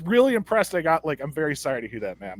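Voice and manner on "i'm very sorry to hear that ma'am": low, soft and fast brush-off; condescending. Retold or imitated. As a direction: rattle off the sympathy without meaning it. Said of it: "I was very condescending".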